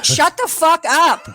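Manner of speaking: slow, emphatic retold outburst; yelling at the TV. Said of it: "I was saying that at the television".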